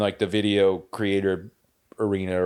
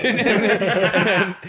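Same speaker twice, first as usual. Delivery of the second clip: breaks into high laughter mid-story; laughing; 11 semitones higher, 5 dB louder than usual. Real, not acted. Laughing while telling friends the tattoo story. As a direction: laugh through the words.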